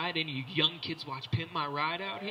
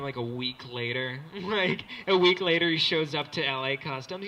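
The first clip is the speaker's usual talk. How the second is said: ordinary talk, nothing marked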